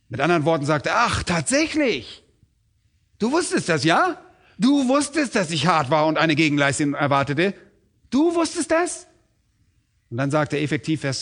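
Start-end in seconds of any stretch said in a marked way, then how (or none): 1.0-9.1 mock him with a high incredulous voice; sarcastic master. Herr verhöhnt Sklaven ironisch. (ironisch ungläubiger Herr, hohe Stimme)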